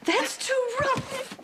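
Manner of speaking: high, slow complaint. Acted. Frail nun complains of rough help.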